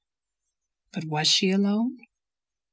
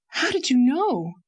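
high, loud startled cry; 7 semitones higher, 4 dB louder than usual. A narrator's reading. Leslie to Anne, exposed, a high startled cry. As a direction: blurt it out, caught off guard. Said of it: "she cried".

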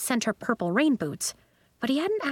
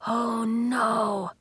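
mildly aghast gasp; a touch of dismay, as if catching breath; 2 semitones higher than usual. A narrator's reading. Sebastian sees the iced instruments, gasping. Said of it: "Sebastian gasped".

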